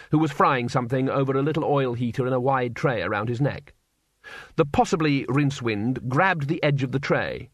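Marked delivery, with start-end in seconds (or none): none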